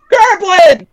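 sudden high, loud shout; exclaimed cry. Acted. Startled character cries out in game.

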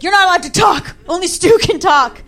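mock authoritative shout; stern scolding. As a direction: shut them down like an angry official. Retold police commissioner silencing her.

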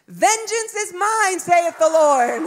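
put-on high, slow child reciting scripture; little girl's voice. Daughter giving mom a scripture, solemn little-girl voice. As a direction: declaim it in a small child's high voice. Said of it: "with her little voice, she goes".

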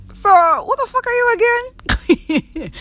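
high, drawn-out mock disbelief; scornful rebuff. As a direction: stretch it out high, putting them in their place. Acted rebuff of nosy acquaintance.

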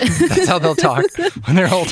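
mock kid voice. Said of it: "That's how my kids talk".

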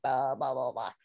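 low, soft mumbled blah blah; mock eyeless pastor. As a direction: drone it out deep and quiet like a creepy pastor. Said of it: "he's all like".

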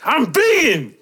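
high, loud yelled outburst; mock scream. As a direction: scream it at the counter in mock outrage. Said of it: "wanted to scream".

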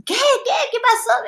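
high-pitched, repeated mock demand; retold urgent questioning. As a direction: press for the answer in a high voice. Retold urgent questioning of laughing mother. (preguntando con urgencia, voz muy aguda)

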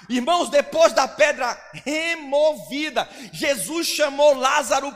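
full-force preaching. Acted. Preacher proclaiming Jesus' orders, full-force preaching. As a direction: drive each command home to the church.

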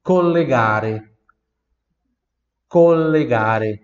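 slow, loud collegare; slow model word. Shown as a demonstration. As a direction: say collegare syllable by syllable. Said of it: "Repita em voz alta". (collegare pronunciado devagar)

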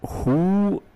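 sudden high-pitched who; surprised who. Retold or imitated. Retelling opening the letter, a high surprised who. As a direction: squeak the question in disbelief. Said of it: "I'm like".

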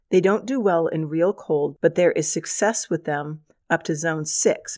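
normal talking voice

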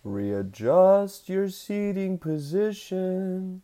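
slow, soft mock announcement; slow announcer. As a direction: drag each word out calmly.

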